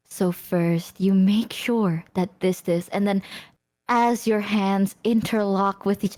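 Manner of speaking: slow, low, breathy explaining voice; mock slow step-by-step romantic instruction; Yusan. Imitating a friend's detailed advice, slow low voice. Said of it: "you know how Yusan says".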